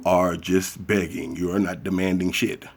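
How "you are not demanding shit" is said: ordinary talk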